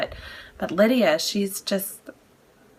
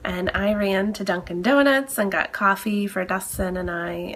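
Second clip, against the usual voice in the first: usual speaking voice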